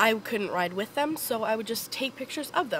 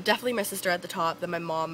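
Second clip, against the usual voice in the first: ordinary talk, nothing marked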